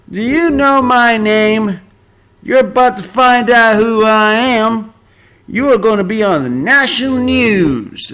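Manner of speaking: high, loud, slow mock diva; entitled celebrity rant. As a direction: shout it like a drunk star pulling rank. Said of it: "Reese Witherspoon pulled out the".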